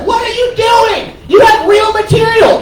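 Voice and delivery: high, exasperated retold outburst; frustrated heckle. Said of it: "I just start getting frustrated by this".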